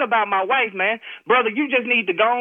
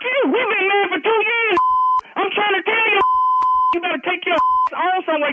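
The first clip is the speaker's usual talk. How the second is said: real furious shout; 8 semitones higher than usual. Real, not acted. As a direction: yell him off your wife in earnest. Real husband threatens the caller.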